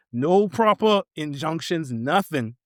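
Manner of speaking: usual speaking voice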